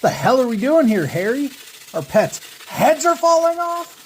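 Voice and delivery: hammed-up movie panic, climbing high on pets' heads; Dumb and Dumber quote. Vlogger quoting Dumb and Dumber about the crow's head, hammed-up yell. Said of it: "I never expected to use this quote".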